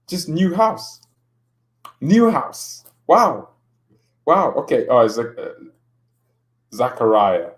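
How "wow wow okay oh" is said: normal talking voice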